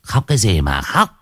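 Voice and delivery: a lightly voiced, scornful old woman; scolding mother-in-law. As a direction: brush off her tears with contempt. Mother-in-law scolds crying wife.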